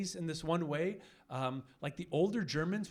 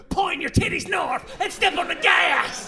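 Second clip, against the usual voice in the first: high, loud shouted movie line; badass shout; 12 semitones higher, 8 dB louder than usual. Comic answering a tourist, loud high badass shout. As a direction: yell it like an action hero. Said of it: "some tourist who's like".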